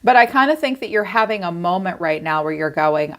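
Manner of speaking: ordinary talk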